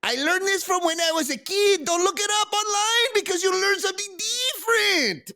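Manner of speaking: very high, hammed-up Spanglish accent; heavy fake Spanish accent. Reading celebrity's cooking quote, in heavy faux Spanish accent. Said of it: "in her best Spanglish accent".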